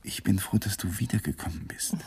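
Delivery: soft whisper back to her; intimate whisper. Acted. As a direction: murmur it so only she hears. Lovers' intimate night talk.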